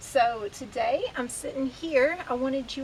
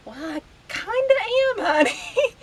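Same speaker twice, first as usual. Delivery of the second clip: loud, high mock reply to son; hammed-up squeaky-high retort, loud; playful high-pitched comeback; 12 semitones higher, 7 dB louder than usual. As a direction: pitch it way up, teasing back. Acted comeback to her son.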